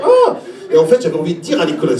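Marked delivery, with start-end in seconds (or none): 0.0-0.4 high-pitched startled "ah"; acted yelp, pitch leaping up; short comic cry of shock; mock cry. Acted reaction to the boy.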